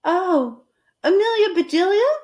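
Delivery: high, rising call; Missus Rogers calling back. Acted. Missus Rogers calling Amelia Bedelia back, high. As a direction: call her back sweetly. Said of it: "said Missus Rogers".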